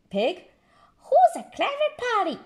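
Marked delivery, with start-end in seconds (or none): 1.1-2.4 high, fast squawky parrot copy. Acted. Polly the parrot repeating Granny squawkily. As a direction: parrot it back quickly. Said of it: "repeats Polly Parrot".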